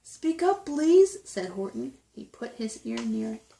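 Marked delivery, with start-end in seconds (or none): 0.0-1.2 high, louder call to someone tiny; raised-pitch storybook call. A narrator's reading. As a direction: coax a faint voice to speak up. Elephant calls to a faint voice.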